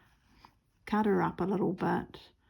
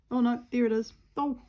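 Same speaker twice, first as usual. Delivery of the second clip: ordinary talk, nothing marked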